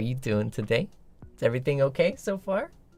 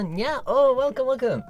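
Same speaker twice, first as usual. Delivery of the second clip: cat meow greeting; 13 semitones higher, slower than usual. Acted.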